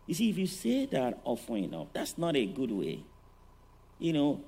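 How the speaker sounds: ordinary talk, nothing marked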